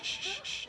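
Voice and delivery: soft unvoiced shh; shushing. Real, not acted. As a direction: hush the room. Quieting a crowd of students.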